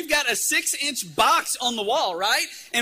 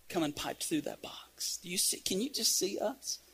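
hushed; 2 semitones lower, 8 dB quieter than usual. Acted. Preacher asking congregation to picture the huddled boys, hushed. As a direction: draw them in very quietly. Draws listeners into the hushed scene.

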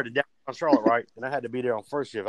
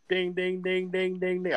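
imitated crossing bell; 6 semitones higher, slower than usual.

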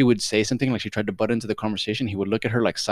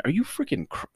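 whispered, pitched up in disbelief; incredulous whisper; 8 semitones higher, 4 dB quieter than usual. Real, not acted. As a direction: whisper it, stunned. Disbelief at the husband.

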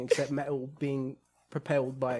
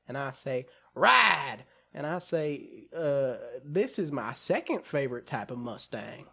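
put-on Southern accent; 3 semitones higher than usual. Podcaster imitating Lucas from the film, mock Southern accent. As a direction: spin a tall tale in a mock Southern twang. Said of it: "do the Southern accent for Lucas".

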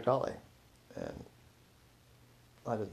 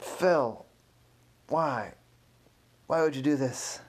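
higher-pitched, exasperated scolding; upset confrontation; 6 semitones higher, 4 dB louder than usual. Acted. Curator confronting Phil over the damaged egg, upset and reproachful. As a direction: demand an explanation in dismay.

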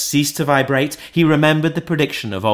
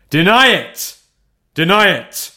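high, loud furious command; spirit's cry; 7 semitones higher, 7 dB louder than usual. A narrator's reading. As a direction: hurl the words at Scrooge. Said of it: "cried the spirit".

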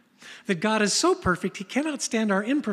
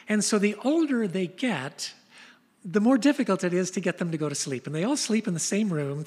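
usual speaking voice

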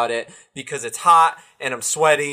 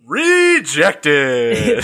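teasing jeer; 5 dB louder than usual. Pretend. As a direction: call it out loud to rib a friend. Friend jeers at job story.

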